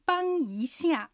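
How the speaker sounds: 帮一下 said very slowly, 帮 level, 一 rising, 下 falling; slow Chinese demo. Shown as a demonstration. Host models 帮一下 for learners, slow and clear. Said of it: "二種類ご紹介します".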